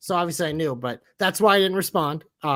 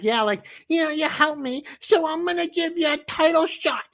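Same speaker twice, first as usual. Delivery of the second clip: higher, mock-sincere babyface voice, rising as it goes; mocking impression; 9 semitones higher than usual. Podcaster mocking a wrestler's promo, in a higher, hammed-up voice. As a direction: ham up a goody-goody wrestler handing out a favor. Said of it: "And it just like".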